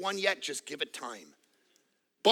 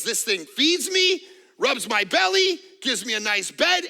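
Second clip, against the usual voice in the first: a hint of an aloof cat, higher and louder; smug cat; 7 semitones higher, 6 dB louder than usual. Preacher voicing a spoiled cat, lightly smug. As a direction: list the perks like a bored pampered cat. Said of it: "this cat says".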